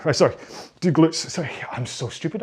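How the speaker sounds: normal talking voice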